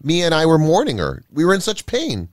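high-pitched, anguished reproach; angry cry. A narrator's reading. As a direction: accuse a friend out of pain. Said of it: "cried Sam angrily".

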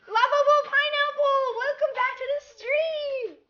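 high, sing-out welcome; real delighted high-pitched hello; excited greeting. Real, not acted. Greeting a returning viewer to stream.

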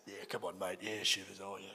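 low, soft blokey Aussie mumble; manly Aussie. Acted. Mocking himself as a manly man, deep Aussie accent. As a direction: drop right down into a manly Australian voice. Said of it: "I sound like such an Aussie, such a manly man".

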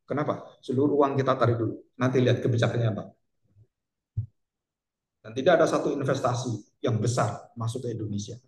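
usual speaking voice

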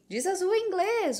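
put-on a parent testing the child; testing command. Parent quizzing child, demanding tone. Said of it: "quando você diz simplesmente".

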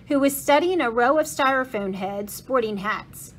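ordinary delivery, nothing marked

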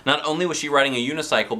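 ordinary delivery, nothing marked

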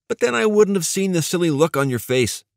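high-pitched, playful gadget inventor voice; teasing tech whiz. Acted. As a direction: tease your friend in a high character voice. Voices Neil's teasing reply.

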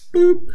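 high, cartoonish "boop" noise; imitated boop. Mimicking an unprovoked hit on the street, high mock boop. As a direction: pop out a short, high-pitched boop. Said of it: "J R was like".

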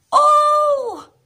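startled exclamation; shocked high oh; surprised oh. Acted.

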